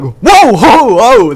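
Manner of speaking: loud, high startled cry; re-enacted scare. Retelling a rubber snake jump scare, startled yelling. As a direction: yelp as if something jumped at your face. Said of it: "that was the biggest scare".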